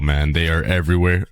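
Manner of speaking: usual speaking voice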